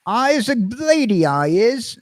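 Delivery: high mock Cockney flower-girl voice; Eliza Doolittle Cockney. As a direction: squeak it out like a bad Eliza Doolittle impression. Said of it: "Eliza Doolittle".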